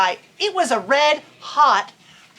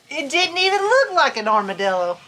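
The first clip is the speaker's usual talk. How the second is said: ordinary delivery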